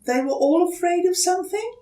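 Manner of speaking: high, quick curious question; mother gently leading her son to a lesson; wondering mother. Acted. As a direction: ask it knowingly.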